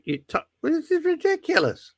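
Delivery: ordinary talk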